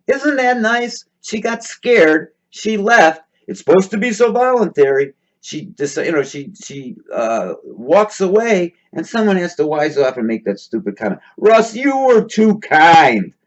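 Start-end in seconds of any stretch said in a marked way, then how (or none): 0.1-3.2 high sarcastic sweetness. Real, not acted. Sarcastic remark on comment. 11.4-13.3 slow, high, mocking sweetness. Mocking comment read.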